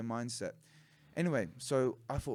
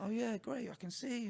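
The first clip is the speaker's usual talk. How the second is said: higher, sarcastic mock praise; a hint of dismissive coach; mock sarcasm; 11 semitones higher than usual. Coach mocking the new boy: high sarcastic praise. The coach mocks his kickboxing.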